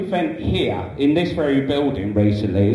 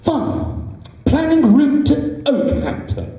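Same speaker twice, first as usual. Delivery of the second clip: much higher, sing-song robot assistant; sat-nav cheerfully greeting the driver; chirpy sat-nav voice; 9 semitones higher, slower than usual. Pretend. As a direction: play a cheery navigation device. Sat-nav spitefully reroutes.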